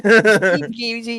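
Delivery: high, loud mock movie quote; imitated movie character. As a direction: repeat the line high, playing the character. Repeats film imitation amid laughter.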